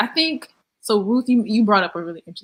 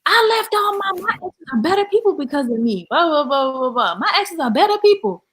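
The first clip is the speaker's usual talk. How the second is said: bragging guy; 8 semitones higher than usual. Retold or imitated. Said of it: "immediately he was like".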